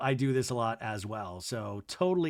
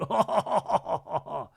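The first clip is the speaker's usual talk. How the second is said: drawn-out put-on laugh, higher voice; imitated laugh; 4 semitones higher than usual. Recapping Jesse's reaction to the girlfriend joke, imitated laugh. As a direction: act out someone else's laughing reaction.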